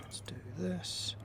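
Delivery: hushed, barely voiced whisper. Real, not acted. Player gearing up for the next move, whispering. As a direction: whisper it to yourself. Player psyching self up softly.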